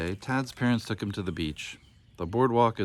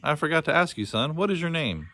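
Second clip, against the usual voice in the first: cheerful; 7 semitones higher than usual. Acted. Booney cheers up the crying boy, cheerful. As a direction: brighten up to distract the boy.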